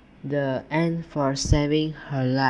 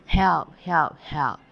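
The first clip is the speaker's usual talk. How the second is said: mild cry for help, louder at the start; 2 semitones higher, 5 dB louder, slower than usual. A narrator's reading. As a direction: call out for help as a story's ant, easing off. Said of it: "cried the ant".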